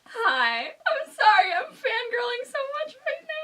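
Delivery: high, laughing, near-crying gush; flustered fan laughing through happy tears; retelling her own giggly fangirl panic; fangirl meltdown. Retold or imitated. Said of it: "being like".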